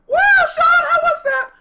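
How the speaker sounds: very high, loud cheer; hyped shouting. Retold or imitated. Crew asking the jumper how it was, high excited shout. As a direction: shriek the question in exhilaration. Crew greets him after landing.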